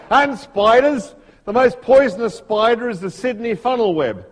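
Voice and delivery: hammed-up Australian accent, exasperated by it all; Struan Sutherland, Australian accent. Snake expert briefing Komodo travellers, weary Australian-accented grumbling. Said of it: "my Australian accent isn't very good".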